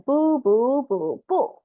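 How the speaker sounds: 不 said bù, loud and falling; fourth tone. Shown as a demonstration. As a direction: snap 不 down hard. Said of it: "All these words are in fourth tone".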